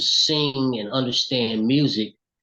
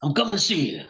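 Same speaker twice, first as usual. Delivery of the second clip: Ollie's voice; 8 semitones higher than usual. Retold or imitated. [name] on the phone asking his mother about the tape, high. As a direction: imitate the singer calling through the phone.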